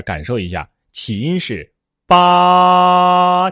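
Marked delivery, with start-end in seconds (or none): 2.0-3.5 八 held long, high and level; drawn-out first tone. Shown as a demonstration. Teacher letting students feel it, 八 long and level. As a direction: stretch 八 on one loud high note. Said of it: "可以拖长一点".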